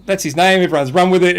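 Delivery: ordinary talk, nothing marked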